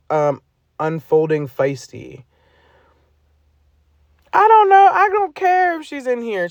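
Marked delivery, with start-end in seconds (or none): 4.3-6.5 real frustrated burst; exasperated outburst. Real, not acted. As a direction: throw your hands up, pitched way up. Sudden high outburst about her.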